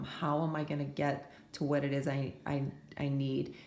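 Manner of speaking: normal talking voice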